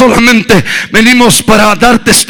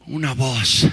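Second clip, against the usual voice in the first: drops to a low, soft hush, then builds; hushed refrain; 12 semitones lower, 9 dB quieter than usual. Acted. Preacher hushes the church on the refrain. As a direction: whisper the refrain to the congregation before building.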